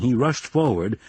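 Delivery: plain reading voice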